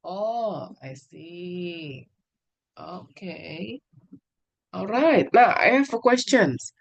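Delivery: usual speaking voice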